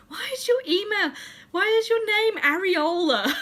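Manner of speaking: high, loud mock-mum questioning; hammed-up impression of a baffled mum; imitating mum. Daughter mimicking mum's question about the name, high mock bafflement. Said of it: "thinking of my mum saying".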